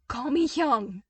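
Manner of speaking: loud, insistent demand. A narrator's reading. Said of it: "Albedo demands".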